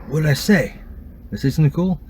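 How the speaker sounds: high, eager questions; curious excitement. Retold or imitated. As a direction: ask like a kid hoping for a cool answer. Retelling eager reaction to paramedics.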